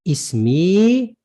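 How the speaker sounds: "ismi" with a long, sustained "ee" ending; elongated final vowel. Shown as a demonstration. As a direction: hold the last "i" of "ismi". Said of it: "You're gonna elongate that kasra".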